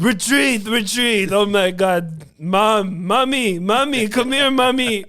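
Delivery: high, loud mock-panicked cries; hammed-up wailing for mommy. Comic acting Putin moved by a poem, hammed-up wailing. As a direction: play a tyrant melting into a child crying for mother.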